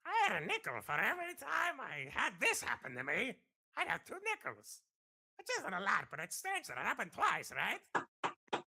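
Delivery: whiny mad scientist voice; Doctor Doofenshmirtz. Retold or imitated. Asked for his favourite, he does Doofenshmirtz. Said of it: "probably Doctor Doofenshmirtz".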